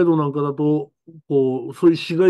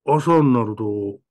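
normal talking voice

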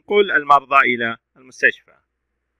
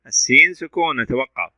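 ordinary delivery

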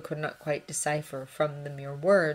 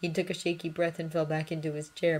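ordinary delivery

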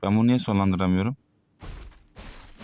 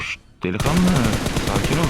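higher, louder mocking hush; taunting calm down; 4 semitones higher, 4 dB louder than usual. Real, not acted. Taunting enemy player.

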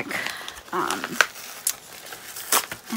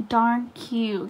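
ordinary delivery

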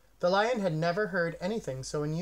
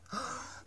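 sharp unvoiced gasp of wonder; astonished gasp; 8 semitones higher, 10 dB quieter than usual. A narrator's reading. Bird sees the lion's new tail, gasping in astonishment. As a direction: gasp in surprise at the orange tail.

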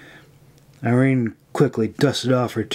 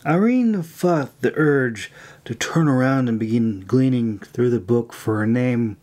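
ordinary delivery, nothing marked

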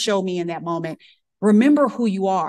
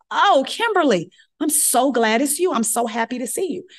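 gushing attending; 6 semitones higher than usual. Retold or imitated. Attending greets her warmly.

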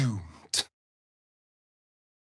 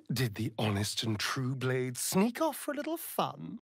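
soft teasing; 8 dB quieter than usual. Acted.

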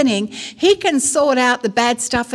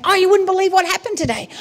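high, fast, gossipy gush; excited; 8 semitones higher, much faster than usual. Acted. Telling God what happened today, excited. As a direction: spill the news eagerly. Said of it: "So exciting".

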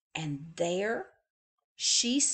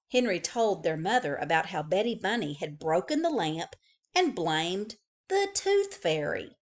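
ordinary delivery, nothing marked

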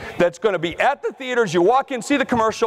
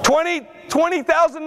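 ordinary talk

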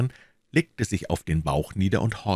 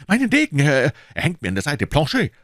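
much higher, louder urgent call to the servant; 9 semitones higher, 5 dB louder than usual. A narrator's reading. D'Artagnan calling to his servant for his sword, urgent. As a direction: snap the order out in a rush.

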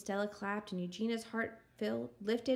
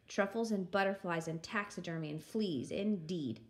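ordinary delivery

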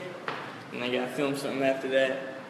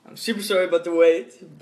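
ordinary talk, nothing marked

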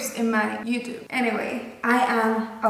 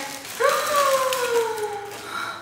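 drawn-out gasp of amazement; stunned joyful gasp; long high gasp; 13 semitones higher than usual. Real, not acted. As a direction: gasp like you can't believe it. Overwhelmed by gift.

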